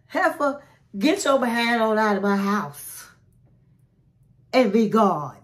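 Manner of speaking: hammed-up get out of my house; telling her off. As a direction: shoo her out the door with attitude.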